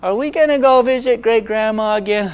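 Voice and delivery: lightly voiced child asking her daddy; little daughter. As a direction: ask it like a little girl to her dad. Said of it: "and she goes".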